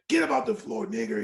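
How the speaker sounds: half-strength retold shout; retold screaming. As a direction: imitate a boss yelling down at someone. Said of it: "he was screaming at me".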